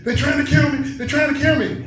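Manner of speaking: high, loud mock-frantic cry; retold panic. Tripping woman phoning her ex-husband for help, retold panic. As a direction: shriek it as an imitation of someone in terror.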